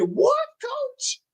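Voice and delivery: high, soft, baffled "What, coach?"; puzzled listener. Retold or imitated. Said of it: "I bet some of y'all are saying".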